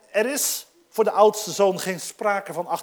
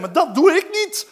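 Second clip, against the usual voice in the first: vastberaden weigering; 8 semitones higher, 7 dB louder than usual. Pretend. Imagined refusal of eldest son.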